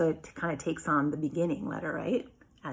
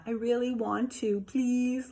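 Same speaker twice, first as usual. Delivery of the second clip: put-on higher whiny pleading child; whining to husband, higher and pleading; begging whine; 6 semitones higher than usual. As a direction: beg like a kid who wants something. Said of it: "and not".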